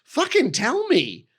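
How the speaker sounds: high, slow, emphatic retort to his wife; exasperated insistence. Retold or imitated. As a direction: spell it out slowly, pitch rising in exasperation. Retold argument, insisting wife just ask.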